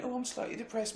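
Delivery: mock self-pity; mock whinging. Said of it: "people are whinging".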